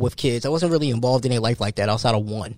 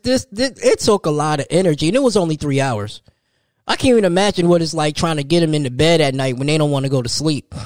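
usual speaking voice

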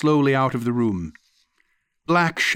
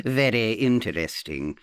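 put-on arch old aunt. Aunt mocking her guest as a philosopher, archly amused. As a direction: savour it with sly amusement.

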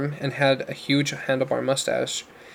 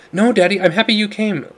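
put-on shouted girl voice; 7 semitones higher, 5 dB louder than usual. A narrator's reading. Girl shouting to deaf father.

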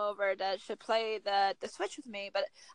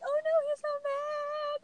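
much higher, slow, squeaky mock-worried girlfriend; imitating the girlfriend. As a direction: put on a high fretting girly voice. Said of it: "And she's like".

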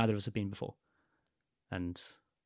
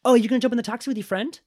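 chirpy woman; 12 semitones higher, 5 dB louder, faster than usual. Retold or imitated. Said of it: "she's like".

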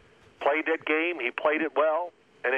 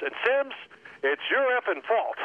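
high, pointed coach jab; gruff coach blaming; 9 semitones higher than usual. Retold or imitated. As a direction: throw the blame like a gruff coach. Coach blaming player in retold story.

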